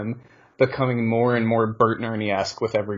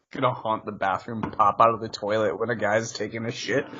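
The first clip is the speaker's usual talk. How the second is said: ordinary talk, nothing marked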